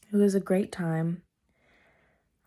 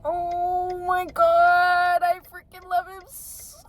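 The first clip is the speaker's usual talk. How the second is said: adoring gush; 8 semitones higher, 6 dB louder, slower than usual. Real, not acted. Gushing over new item.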